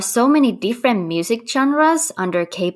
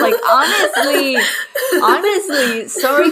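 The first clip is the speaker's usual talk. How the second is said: laughing; 7 semitones higher than usual. Real, not acted. Laughing at own shallow remark.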